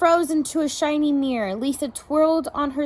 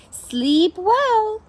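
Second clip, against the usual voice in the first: pony; 6 semitones higher, 3 dB louder than usual. A narrator's reading. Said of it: "he neighed".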